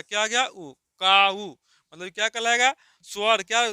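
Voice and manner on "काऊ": "काऊ" stretched slowly to expose the ऊ; drawn-out vowel ending. Shown as a demonstration. Teacher showing pupils the ऊ sound in "काऊ", drawn out. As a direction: draw out "काऊ" so the final ऊ rings separately. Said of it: "काऊ मतलब ऊ आ गया".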